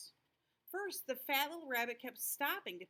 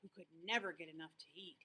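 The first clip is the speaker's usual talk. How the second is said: usual speaking voice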